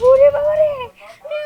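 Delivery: loud, high cry of fright; terrified cry. Acted. Thief crying out in terror at the ghost bike, loud shriek. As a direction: shriek in panic.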